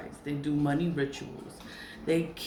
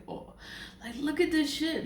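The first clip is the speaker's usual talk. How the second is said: much higher, mocking look-at-this; outraged disbelief; 10 semitones higher than usual. Seeing the ritual on their stories, scandalized and high. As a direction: pitch way up in scandalized amazement. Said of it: "I'm just like".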